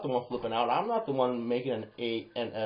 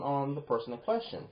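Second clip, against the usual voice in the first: normal talking voice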